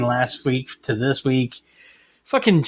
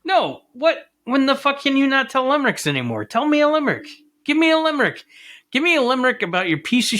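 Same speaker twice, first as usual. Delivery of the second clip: exasperated; 9 semitones higher than usual. Real, not acted. Exasperated demand at chatbot.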